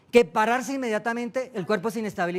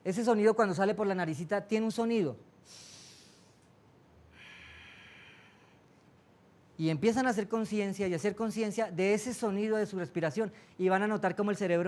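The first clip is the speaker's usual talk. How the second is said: guided meditation. Acted. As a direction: lead them gently into calm breathing. Guía una meditación. (voz de meditación guiada, suave)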